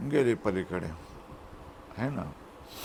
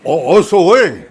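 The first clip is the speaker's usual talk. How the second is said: laughing ओ असं होय louder; delighted laughing realization; surprised laugh; 4 semitones higher, 10 dB louder, much slower than usual. Retold or imitated. The boy laughing in sudden realization. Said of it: "boy realizes".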